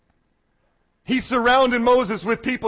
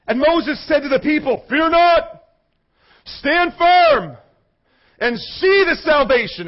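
raised, forceful battle-speech delivery; rousing scripture proclamation; 4 semitones higher than usual. Acted.

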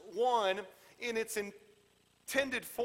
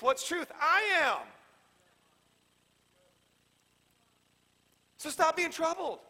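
high-pitched, exasperated scolding; urgent, insistent telling-off with the voice pushed up; stern Jesus; 4 semitones higher than usual. Acted. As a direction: scold them into calming down. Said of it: "Jesus is the guy that says, "Hey".

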